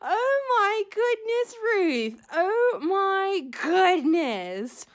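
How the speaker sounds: high, slow, drawn-out amazement; amazed delight. Real, not acted. Thanking a friend for a longed-for shell, amazed. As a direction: stretch the words out in real wonder.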